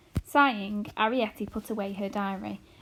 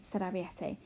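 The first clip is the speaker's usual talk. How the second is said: usual speaking voice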